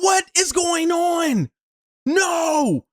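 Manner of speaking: high, slow yell of disbelief; frustrated disbelief. Retold or imitated. Runner yelling at his own feet, frustrated disbelief. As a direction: cry out at the fall.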